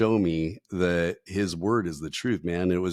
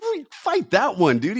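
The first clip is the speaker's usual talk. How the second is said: much higher, slow, louder indignant mock protest; indignant retort; 13 semitones higher, 5 dB louder, slower than usual. Telling the pastor to fight that one, a high slow indignant protest. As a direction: bristle back at the pastor, stretched out.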